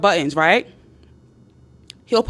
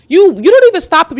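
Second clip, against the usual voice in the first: ordinary talk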